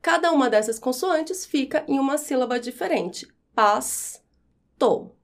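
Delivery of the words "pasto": "Pasto" split into pas, to, with a long pause; syllable by syllable. Shown as a demonstration. Teacher separating S from T, syllable by syllable. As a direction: hold S at the end of the first beat of "Pasto". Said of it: "quando eu vou separar as sílabas".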